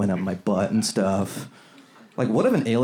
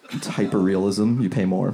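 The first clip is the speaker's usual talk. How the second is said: ordinary talk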